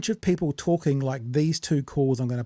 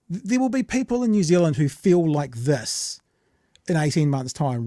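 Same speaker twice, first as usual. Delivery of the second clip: ordinary delivery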